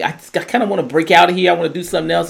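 lightly acted exasperation; frustrated Steve. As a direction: imitate someone fed up and wanting out. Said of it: "how much Steve is frustrated".

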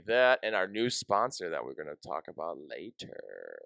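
low, smooth ad-read voice; sexy sponsor voice. Shown as a demonstration. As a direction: purr it like a late-night ad. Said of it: "That was my sexy sponsor voice".

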